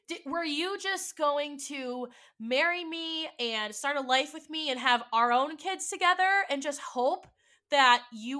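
loud, high accusing rant; furious, building loud accusation; angry tirade. Retold or imitated. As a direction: pour out the betrayal in anger. Confronting partner about secret child.

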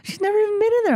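very high-pitched disbelief; mimicked wife's astonishment; shocked wife. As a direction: exclaim like an astonished mom. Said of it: "she's like".

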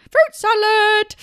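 very high, loud and slow; high disguised voice. Retold or imitated. A presenter imitates a teacher's disguised voice, very high and loud. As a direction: sing it out high and silly.

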